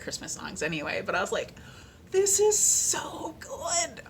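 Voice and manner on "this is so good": breathless higher whisper; awed whisper. Real, not acted. Listener awed by the audiobook narrator's singing, hushed whisper. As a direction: whisper in awe at the beauty.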